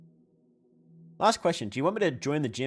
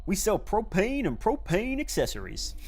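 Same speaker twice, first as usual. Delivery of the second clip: slow, higher mock Texan drawl; Hank Hill; 8 semitones higher, slower than usual. Streamer doing Hank Hill for chat, slow mock drawl. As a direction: play the propane salesman slowly. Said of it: "You're a Hank Hill impression".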